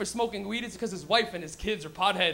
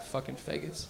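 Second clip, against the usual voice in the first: muttered insult; 8 semitones lower, 10 dB quieter than usual. Retold or imitated. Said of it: "you go".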